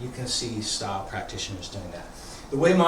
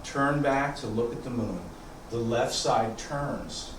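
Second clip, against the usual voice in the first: quiet unhurried cues, guiding the form; serene tai chi cues; 4 semitones lower, 8 dB quieter than usual. Acted.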